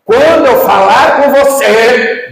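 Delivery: high, loud and slow imitation of a yelling judge; mock shout. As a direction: scream it at the witness.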